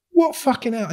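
high, loud, incredulous snap back; retold heated retort. Retorting to the accuser, loud disbelief. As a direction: fire the question back in disbelief.

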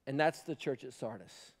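hushed. Real, not acted. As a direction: drop to a sombre hush. Hushed repeat after shouting.

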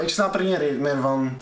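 ordinary talk

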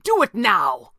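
urgent villain's command; demanding. A narrator's reading. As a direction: demand it at once, forcefully. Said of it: "Thatcher demanded".

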